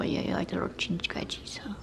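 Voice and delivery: hushed coo to a pet. Real, not acted. Cooing to the cat over chin scratchies, whispered. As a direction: whisper the cute words softly.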